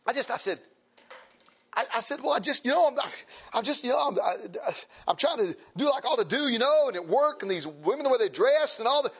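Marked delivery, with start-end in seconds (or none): 2.2-9.1 lightly acted, higher-pitched stammered excuse; a hint of a flustered excuse-maker; flustered young man. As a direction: hem and haw defensively. Retold young man making excuses.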